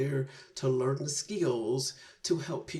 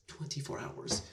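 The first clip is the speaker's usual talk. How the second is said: Twenty-four hours breathed as a whisper; whispered echo of Twenty-four hours; awed whisper; 10 dB quieter than usual. Real, not acted. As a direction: drop to a hushed whisper of disbelief. Repeats it in awe.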